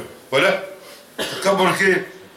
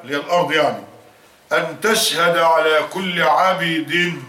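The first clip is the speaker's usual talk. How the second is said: slow, measured recitation of the Prophet's words; solemn, unhurried quoting of scripture; slow hadith recitation; slower than usual. Acted. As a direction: lay out each phrase slowly and deliberately. Preacher reciting the hadith slowly.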